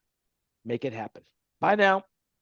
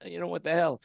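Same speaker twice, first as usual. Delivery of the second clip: usual speaking voice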